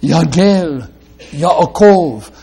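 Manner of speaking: "Yalgel Yaakov" with "Ya" syllables leaned on; Hebrew rhyme demo. Shown as a demonstration. Preacher pointing out the rhyming first syllables, lightly stressed. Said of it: "rhyming ... in the first syllables".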